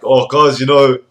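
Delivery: lightly voiced bragging gym bro; mock a protein-chugging lad; meathead gym bro.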